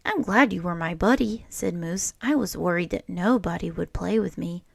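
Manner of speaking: put-on lively read-aloud voice for children; storybook reading. A narrator's reading. Teacher reading a picture book to children, lively storytelling. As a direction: read it like story time for a class.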